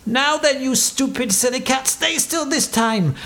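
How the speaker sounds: high-pitched, exasperated scolding; getting angry. A narrator's reading. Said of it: "The captain was getting angry".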